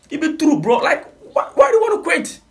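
high, excited protest against quitting. Real, not acted. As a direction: plead it high and urgent. Urging him not to quit.

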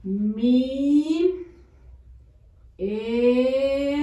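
"Mi" "er" split apart; syllable by syllable. Shown as a demonstration. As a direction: spell out "Mi, er" slowly. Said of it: "con el acento en la E". (separando sílabas)

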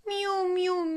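high meow; a high drawn-out meow; cat meow. Acted. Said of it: "grogna le chat".

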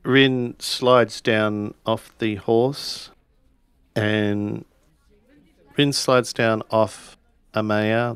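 ordinary delivery

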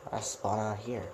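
ordinary talk

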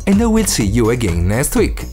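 usual speaking voice

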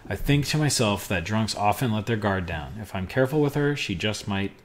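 pulpy private-eye narration, half performed; detective narration. Acted. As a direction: read it like a hard-boiled detective voiceover. Reads game narration aloud.

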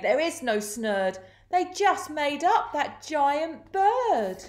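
rising, drawn-out crook's complaint; complaining. A narrator's reading. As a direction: build from grumble to high, slow outrage. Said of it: "And Len complained".

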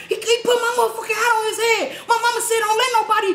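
high, fast indignant yell; mock outraged shout. Explaining to her brother why she hit him, high yell. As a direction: rant it high and fast, half laughing. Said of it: "I'm like".

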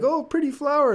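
voicing the fly, high and delighted; fly's delighted voice. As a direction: coo it like a thrilled fly spotting a flower. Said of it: "And so he's like".

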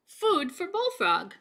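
high, loud, fast crowd cheer; yelling. A narrator's reading. Townsfolk yelling to feed Bullfrog. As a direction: call out eagerly together. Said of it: "they yelled".

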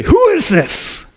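high, slow, outraged question; angry demand. Acted. David outraged at Nathan's story, high slow angry demand. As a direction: demand to know who. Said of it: "And he's angry".

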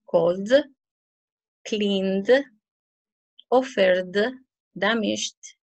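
"called", "cleaned", "loved" each ending in a sounded "d"; word list with pauses, "-ed" said as d without an "id" syllable; teacher modelling "call-d", "clean-d", "rub-d" one at a time. Shown as a demonstration. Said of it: "tú suenas d".

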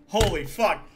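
shocked shout. Real, not acted. Shocked at own misreading.

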